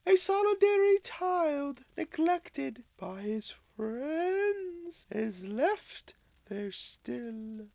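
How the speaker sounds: high, slow spirit trailing away. Acted. Ghost telling Scrooge of the neglected child, high and fading. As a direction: let the sorrow fade out slowly.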